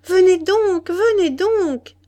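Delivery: high, loud, insistent call; drawn-out, ringing summons. A narrator's reading. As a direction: call someone over from a distance. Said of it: "cria-t-elle".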